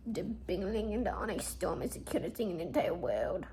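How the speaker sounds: soft adoring whisper; hushed, fond whisper. Real, not acted. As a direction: whisper it to the plush. Whispering over cute plush.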